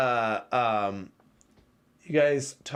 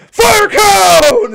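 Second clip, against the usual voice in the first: spell shouted; 17 dB louder than usual. Retold or imitated. Casting spell on the forest.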